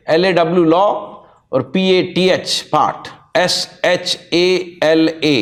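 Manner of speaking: slow letter-by-letter dictation; spelling it out slowly. Shown as a demonstration. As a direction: dictate each letter so students can write it down. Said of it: "spelling भी लिख लीजिएगा ध्यान से". (धीरे-धीरे अक्षर बोलकर spelling लिखवाना)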